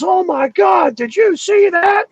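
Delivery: startled cry at something in the sky; excited shout. Retold or imitated. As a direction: yell it like you can't believe your eyes. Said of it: "Gene Huff goes".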